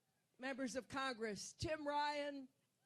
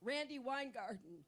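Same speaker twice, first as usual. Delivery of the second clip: ordinary delivery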